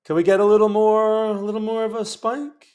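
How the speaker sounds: lightly voiced bad Seinfeld bit; Jerry Seinfeld. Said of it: "My bad Jerry Seinfeld impression".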